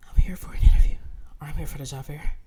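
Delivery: breathless whisper, barely making noise; winded from climbing three flights of stairs; out of breath. Retold or imitated. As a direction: gasp the words out after the stairs. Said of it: "I'm so out of breath".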